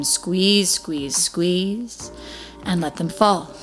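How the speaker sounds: ordinary talk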